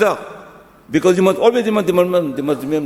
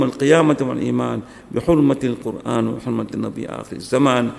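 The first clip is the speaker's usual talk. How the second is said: fast, low Arabic supplication; rapid recited dua; 4 semitones lower, much faster than usual. Real, not acted. Imam praying for the couple, fast and low. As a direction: rattle through the dua quickly.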